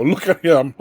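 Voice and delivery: put-on high, loud taunting kid; mocking kid. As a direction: tease like a schoolkid. Kids mocking, retold.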